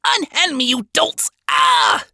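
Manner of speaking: high-pitched cry for release; indignant protest. Acted. Scientist seized by a group of sinners, indignant protest. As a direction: protest as you are being grabbed. Baxter grabbed and dragged off.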